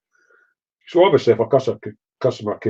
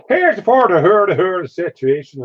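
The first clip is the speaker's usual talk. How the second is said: mocking Northern Irish accent, pitched much higher and louder; 7 semitones higher, 4 dB louder than usual. Teasing his Northern Irish friend John in a mock Northern Irish accent. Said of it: "take the mick out of him and go".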